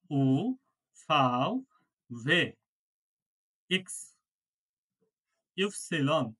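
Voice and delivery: each letter as its German name, from আ to সেট, spaced out; slow letter-by-letter German alphabet; reciting German alphabet. Shown as a demonstration. Teacher reads the German alphabet aloud for students. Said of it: "আপনারা মনে মনে পড়েন".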